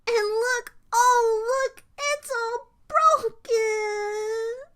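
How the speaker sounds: high, drawn-out tearful wail; crying Baby Bear. A narrator's reading. Baby Bear finding his chair broken, crying. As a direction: wail it high, stretching the last word. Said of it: "started to cry real tears".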